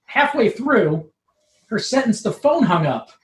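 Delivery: normal talking voice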